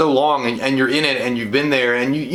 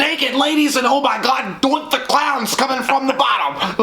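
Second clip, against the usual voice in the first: oversell it like a wrestling hype man; hype announcer; 8 semitones higher than usual. Pretend. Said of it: "I'm like".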